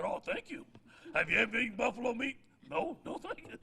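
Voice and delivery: hammed-up polite no; polite dodge. Coach politely refusing buffalo meat, hammed-up. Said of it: "I said".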